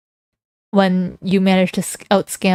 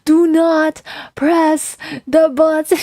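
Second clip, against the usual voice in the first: high, slow panicked scammer voice; stressed scammer imitation; 7 semitones higher, much slower than usual. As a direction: mimic a frantic scammer pleading. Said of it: "the guy's like".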